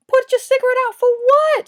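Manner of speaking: high, loud incredulous challenge; loud, high disbelief at an order; incredulous outburst. Retold or imitated. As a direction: fire the question back, outraged. Quoting her challenge to cop.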